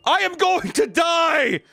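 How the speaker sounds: high, slow, drawn-out shout; desperate yell. Retold or imitated. Retelling the dying student yelling to the paramedic, mock shout. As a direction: wail it like you know it's the end.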